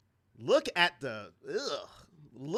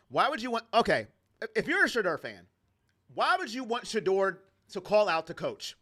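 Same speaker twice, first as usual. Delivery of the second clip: ordinary talk, nothing marked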